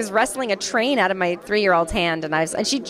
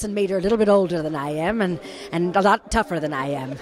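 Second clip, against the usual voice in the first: lightly put-on Irish accent, lower; a hint of an old Irish nun, lower pitch; 5 semitones lower than usual. Shown as a demonstration. Actress demonstrating her character's Irish accent to an interviewer, light Irish lilt. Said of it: "I did kind of an Irish accent like this".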